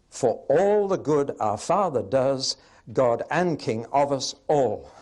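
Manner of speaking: loud, slow singsong meter landing hard on "and" in "God and King"; thumped-out verse with the weight on "and", not "God"; misplaced beat on AND. Shown as a demonstration. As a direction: stress "and" instead of "God", jarringly. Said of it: "the beat comes on the "and" instead of "God".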